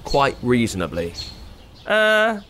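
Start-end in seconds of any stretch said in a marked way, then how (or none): 1.9-2.4 high, hesitant excuse; stall, then blurt it out high; awkward princess. Acted.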